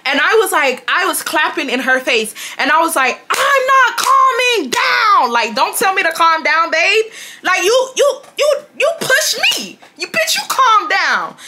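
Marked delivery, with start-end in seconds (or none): 3.3-11.4 high-pitched re-enacted tirade; heated, squealing mock outrage; defiant. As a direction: stretch the refusal high, then keep arguing back. Own defiant shouting in quarrel.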